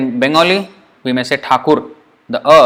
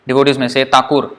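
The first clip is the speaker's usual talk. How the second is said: ordinary talk, nothing marked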